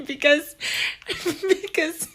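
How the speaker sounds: high, breaking through laughter; telling about the brother's mix-up, cracking up; real laughter. Real, not acted. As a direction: squeak it out mid-laugh. Laughing too hard to speak.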